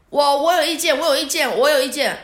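high, loud, insistent repeated protest; the driver bursting to get a word in; excited cab driver cutting in; eager objection. Retold or imitated. Excited driver raising his hand.

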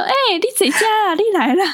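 high, chirpy auntie voice calling out; mimicking an auntie. Retelling aunties greeting their friend, in a high put-on auntie voice. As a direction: play a chatty metro auntie greeting friends.

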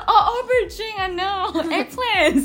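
laughing quote. Retold or imitated. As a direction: mimic the friend while cracking up. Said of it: "然后他说".

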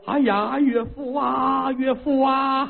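high, slow mock lament; fake wailing. Emperor fake-mourns his father-in-law with a high drawn wail. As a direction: ham up the grief, none of it real.

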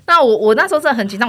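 usual speaking voice

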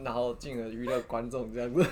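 usual speaking voice

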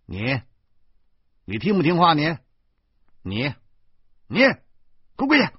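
stammering up to a high, loud command; sputter, then yell the order; domineering fatherly outburst; bullying anger. Acted. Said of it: "我的气焰又嚣张了几分".

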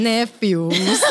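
ordinary talk, nothing marked

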